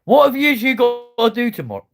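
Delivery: high, loud shout across to them; calling out. Real, not acted. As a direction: holler the question over to the pair. Calls out to two others.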